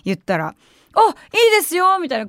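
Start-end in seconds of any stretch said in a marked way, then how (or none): 0.9-2.0 bright, high, slow "sure, no problem"; polite staff voice. Retold or imitated. Clerk cheerfully agrees to make off-menu dish, high and slow. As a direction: play the shop clerk happily agreeing, pitched up.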